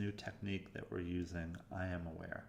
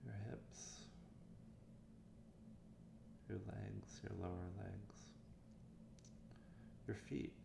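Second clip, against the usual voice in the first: softer meditation voice with long pauses; guided meditation; 7 dB quieter than usual. Acted. Meditation coach leading listeners through warm-up exercises, guided meditation voice. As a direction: guide listeners gently, leaving room between phrases.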